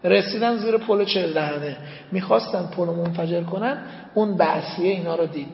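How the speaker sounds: ordinary talk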